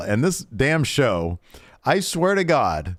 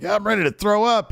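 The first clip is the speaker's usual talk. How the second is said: much higher-pitched mock nerves; imitated nervous wrestler; 9 semitones higher, faster than usual. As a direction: act out a jittery student in a raised voice. Acting Thea's nervous reply.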